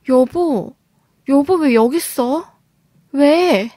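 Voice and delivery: narrated startled yell, held back; startled cry. A narrator's reading. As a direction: cry out at being caught by a husband. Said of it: "하면서 소리를 질렀고".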